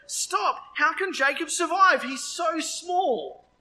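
high-pitched, urgent appeal to God; crying out in plea. A narrator's reading. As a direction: plead for it to stop, stretching the final words. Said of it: "I cried out".

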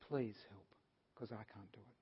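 very soft, low, weakened plea; helpless plea. Acted. Father giving his boy to Jesus, quietly pleading. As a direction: sink to a hushed admission of helplessness.

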